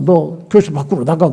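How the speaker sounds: ordinary talk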